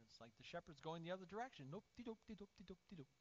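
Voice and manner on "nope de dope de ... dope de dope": quick, higher, soft nonsense patter; oblivious sheep. Acted. Dumb sheep wandering away from the shepherd, oblivious patter. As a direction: bumble along obliviously in a silly voice.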